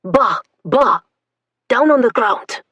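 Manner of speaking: loud barked command; barked order. Acted. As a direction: bark at them. Soldier commanding prisoners.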